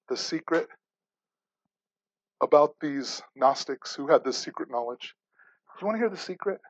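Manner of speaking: hushed like sharing a secret; hushed teasing. Acted. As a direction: lean in and dangle the secret quietly. Preacher teasing congregation about secret.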